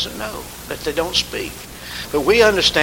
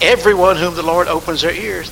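louder sermon drive; fervent preaching; 6 dB louder than usual. Acted. Preaching at full force.

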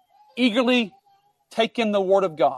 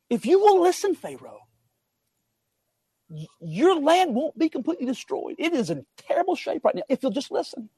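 earnest appeal; 7 semitones higher, 4 dB quieter than usual. Acted. God appealing to Pharaoh to listen.

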